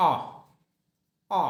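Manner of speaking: আ, said with no r sound at all; non-rhotic R. Shown as a demonstration. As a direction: drop the r, just আ. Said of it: "আর এর উচ্চারণ হলো আ". (আর না বলে শুধু আ)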